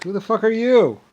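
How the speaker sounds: loud, high indignant shout; angry yell. Real, not acted. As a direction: throw the question out in outrage. Furious rhetorical rant question.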